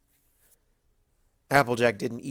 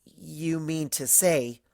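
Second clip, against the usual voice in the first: very slow, higher, piecing it together; said slowly; 5 semitones higher, much slower than usual. Acted. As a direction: drag each word as it dawns on you. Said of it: "Twilight said slowly".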